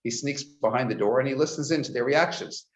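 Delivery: normal talking voice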